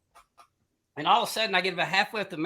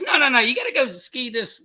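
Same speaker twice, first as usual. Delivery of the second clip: higher, louder retold protest; insistent objection; 7 semitones higher, 4 dB louder than usual. Friend talking him into skiing the hill, insistent. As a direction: cut in and urge him on.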